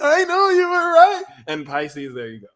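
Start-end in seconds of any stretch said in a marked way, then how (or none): 0.0-1.2 very high, loud whiny wail; hammed-up tearful admission; mock sobbing. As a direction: blubber it in a squeaky put-on voice. Acting the crying friend's reply.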